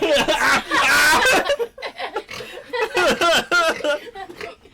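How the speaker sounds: shrieking laugh, high and loud; high burst of laughter. Real, not acted. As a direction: crack up hard. Joke about being Italian.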